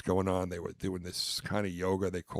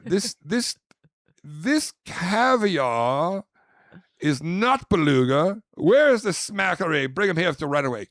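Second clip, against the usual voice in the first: high, loud, hammed-up indignation; pretend outraged guest; 7 semitones higher, 9 dB louder than usual. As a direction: stretch the words in mounting mock outrage. Plays outraged caviar guest.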